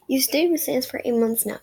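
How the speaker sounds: ordinary delivery, nothing marked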